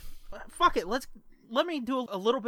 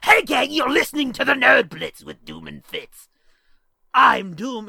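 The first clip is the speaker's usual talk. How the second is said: loud, put-on podcast intro voice; show-opening voice; 3 semitones higher, 11 dB louder than usual. Shown as a demonstration. Host demonstrating his episode opener, loud put-on voice. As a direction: belt out the show's greeting in character. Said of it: "a voice to open every episode".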